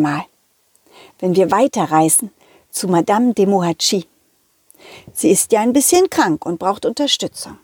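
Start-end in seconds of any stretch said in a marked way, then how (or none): none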